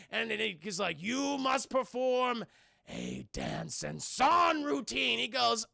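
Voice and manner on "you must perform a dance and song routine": slow, portentous pronouncement; drawn-out mock demon voice. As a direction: intone the command slowly as a demon. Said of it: "turns into a fucking demon".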